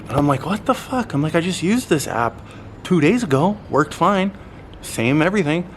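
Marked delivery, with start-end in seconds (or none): 0.4-1.1 much higher, stunned what the fuck; incredulous cry at the failed payment; disbelieving outburst. Retold or imitated. 1.3-5.7 higher, frustrated rant about the app; venting disbelief; exasperated complaint. Retold or imitated.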